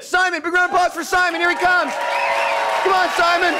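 high, rallying MC call; stage announcing. Real, not acted. Host bringing an audience volunteer up, announcing high. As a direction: whip up applause in a high voice.

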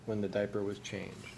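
ordinary delivery, nothing marked

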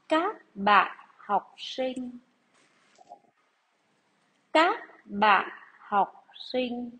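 slow, deliberate dictation read. Acted. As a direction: read each phrase out for pupils to write. Teacher reads dictation to class.

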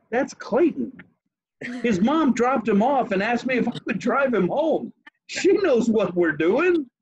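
high-pitched, emphatic retort; acted exasperated explaining, stretched at the end; insistent defense. Retells defending himself to worried friend.

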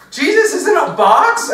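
loud, high-pitched shocked question; hammed-up outraged disbelief; incredulous. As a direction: blurt it out high and loud, aghast. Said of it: "but it wasn't more like".

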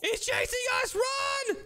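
very high frantic squeal; panicked cry. Real, not acted. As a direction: cry out as you flee a chasing monster. Fleeing the monster.

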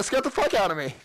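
usual speaking voice

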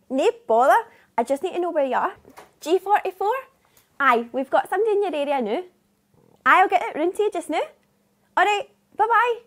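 chirpy broad Scottish phone voice, fast; brisk Scottish dispatcher on the phone; broad scottish accent. Acted. Dispatcher taking a phone booking.

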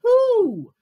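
high, loud, long who; moose bellow. A narrator's reading. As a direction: bellow it out at full force. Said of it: "bellowed Moose".

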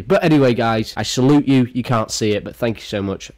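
ordinary talk, nothing marked

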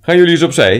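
high, loud shout to a crowd; called out. A narrator's reading. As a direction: call out sharply to clear the way. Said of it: "riep hij".

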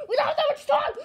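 high, fast, panicked yell about the time; panicked shout. Acted. Friend realises they're late, panicked high shout.

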